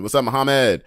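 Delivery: usual speaking voice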